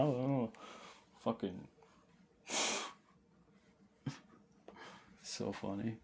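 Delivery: ordinary delivery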